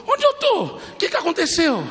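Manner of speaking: very high, startled imitation of a woman; bewildered, high-pitched questions; freed woman's voice. Preacher voices the freed woman asking the crowd, high and bewildered. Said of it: "eu vi a voz dela".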